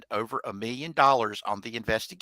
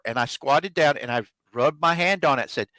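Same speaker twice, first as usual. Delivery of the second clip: ordinary delivery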